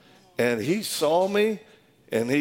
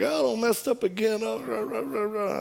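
high whiny babble, trailing into nonsense; mock blubbering excuses; 7 semitones higher than usual. Sweating husband confessing to the pastor, mock blubbering. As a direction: mimic a pathetic confession with dismissive blah-blah.

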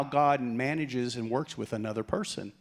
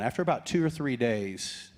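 usual speaking voice

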